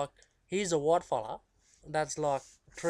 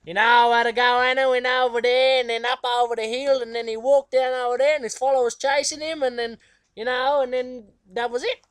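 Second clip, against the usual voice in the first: put-on much higher, louder old granny storytelling; Nana telling a story; 8 semitones higher, 10 dB louder than usual. Nana telling a mission story to the kids, high and loud. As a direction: spin the old people's tale in a high lilting granny voice. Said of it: "she'd be like".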